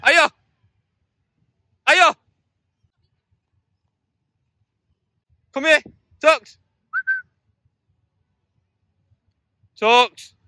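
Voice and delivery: loud high recall shout; calling the dog. Real, not acted. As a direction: shout the dog's name across a field. Calling dog back again.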